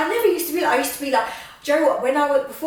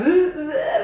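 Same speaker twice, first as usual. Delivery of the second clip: higher, laughing flustered "ooh"s; flustered noise; 6 semitones higher, 4 dB louder, slower than usual. Retold or imitated. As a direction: act out getting flustered with silly little oohs. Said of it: "I got, I start going".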